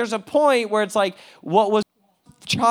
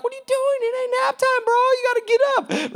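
high, mocking boss voice, way up in pitch; high-pitched mock scolding of a sleeping worker; 5 dB louder than usual. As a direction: squeal it like an exasperated boss. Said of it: "they're like".

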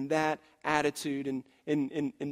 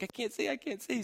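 put-on little girl whining; 6 semitones higher, 7 dB quieter than usual. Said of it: "She's like".

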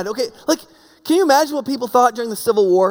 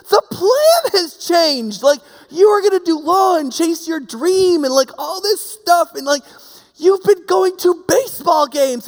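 higher, louder and slow, hammering each point; a husband who has had enough; fed-up husband lecturing his wife, raised and slow; exasperated scolding; 7 semitones higher, 4 dB louder than usual. Retold or imitated.

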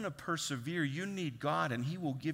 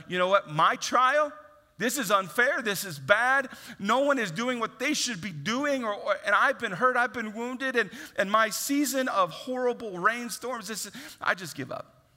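whiny self-pity rant; 7 semitones higher, 6 dB louder than usual. Retold or imitated. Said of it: "self-pity".

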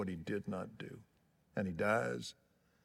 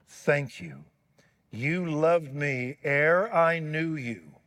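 fervent prayer; 2 semitones higher, 5 dB louder than usual. Real, not acted. Preacher leads the church in communion prayer, fervent. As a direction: pray it with full conviction.

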